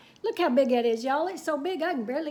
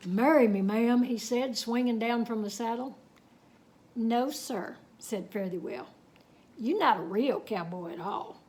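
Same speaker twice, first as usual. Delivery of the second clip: unhurried Texas tall-tale telling; story narration; 5 dB louder than usual. A narrator's reading. Reading a cowboy tale to children, storytelling. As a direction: spin the yarn for the kids.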